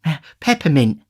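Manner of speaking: high, faint lady's exclamation. A narrator's reading. Matron tells the beadle what she drank, faint voice. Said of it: "exclaimed Missus Corney in a faint voice".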